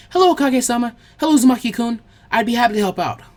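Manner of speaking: polite, eager apprentice greeting; young girl voice. Acted. Shiho's voice held.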